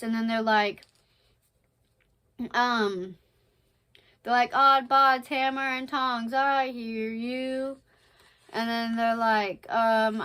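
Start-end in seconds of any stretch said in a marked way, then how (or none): 4.5-7.8 loud start, drawn-out finish; play-acted pirate bluster; blustering stage pirate. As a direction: stretch the oath out theatrically.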